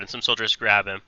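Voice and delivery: normal talking voice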